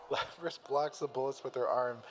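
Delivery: ordinary delivery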